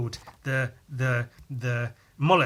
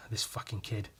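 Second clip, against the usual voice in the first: annoyed mutter; 3 semitones lower, 12 dB quieter than usual. Retold or imitated. Exasperated grumble at kid.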